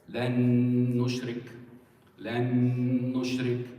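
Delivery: "Lan nushrik" with a long, loud held n; emphasis on second nun. Shown as a demonstration. Teacher demonstrating idgham to students, long humming n in "Lan nushrik". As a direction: hold the joined n of "Lan nushrik" slowly on one note. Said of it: "That's the emphasis on the second nun".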